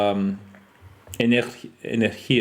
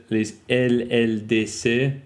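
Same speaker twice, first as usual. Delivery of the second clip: French letter names, drawn out; French accent; slower than usual. Real, not acted. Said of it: "am I talking in a French accent".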